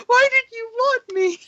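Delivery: very high, hammed-up crying; mock tearful wail. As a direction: wail it like an abandoned child, hammed up. Abandoned son confronts father, acted.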